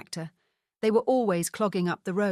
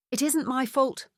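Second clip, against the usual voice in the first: higher, protesting "It isn't my fault"; exclaiming; 6 semitones higher than usual. A narrator's reading. Trinity protesting to the haughty ghost, higher exclamation. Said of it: "I cried".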